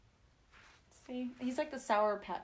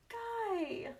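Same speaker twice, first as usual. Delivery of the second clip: disappointed; 13 semitones higher, 3 dB quieter than usual. Retold or imitated. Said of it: "she was so disappointed".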